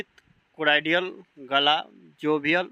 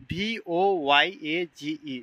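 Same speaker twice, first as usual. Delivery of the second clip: slow letter-by-letter spelling; much slower than usual. Shown as a demonstration. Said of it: "teacher spells out voyage".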